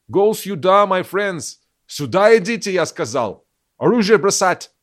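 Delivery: put-on shouting in a heavy English accent. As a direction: bark it out like an English soldier. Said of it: "орали с английским акцентом". (крик с английским акцентом)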